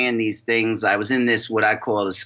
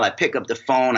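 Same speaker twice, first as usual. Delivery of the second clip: ordinary talk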